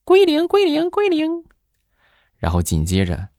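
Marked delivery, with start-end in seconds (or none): 0.0-1.4 high sing-song machine voice, far higher and louder; piping electronic woman's voice; melodious female voice. Retold or imitated. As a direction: mimic the calculator's lilting lady voice. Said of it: "传来了一声悠扬的女声".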